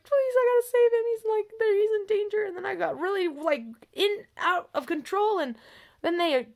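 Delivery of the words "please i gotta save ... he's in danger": high-pitched urgent pleading; retold frantic plea. Begging to save someone in danger, high frantic plea.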